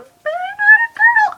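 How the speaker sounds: extremely high, drawn-out squeal; whiny protest. Retold or imitated. Protesting being taken for a girl, high whine. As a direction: squeak it out slowly in falsetto.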